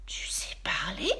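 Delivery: soft whispered astonishment; astonished. A narrator's reading. Elmer amazed that the teddy talks, whispered surprise. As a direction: breathe it out amazed. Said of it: "s'exclame étonné Elmer".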